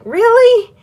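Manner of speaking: high, loud incredulous really; surprised. Real, not acted. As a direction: throw it up in disbelief. Disbelief at others' luck.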